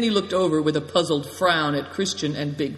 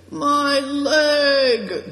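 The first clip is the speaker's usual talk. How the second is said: high, loud wail of pain; acted pained, stretched-out cry; groaning; 11 semitones higher, 6 dB louder than usual. A narrator's reading. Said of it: "groaned Greencoat".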